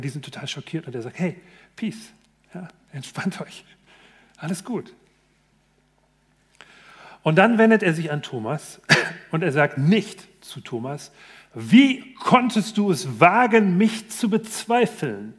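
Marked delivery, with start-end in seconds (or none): 1.2-4.9 soft, easygoing calming words; relaxed reassurance. Retold or imitated. Jesus calms his shocked disciples, softly reassuring. 11.5-15.3 slow, higher, indignant rebuke; hammed-up wounded accusation; mock reproach. Jesus as he does not speak to Thomas, mock indignant. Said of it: "Das sagt er nicht".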